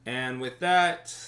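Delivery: usual speaking voice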